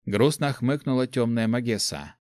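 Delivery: ordinary delivery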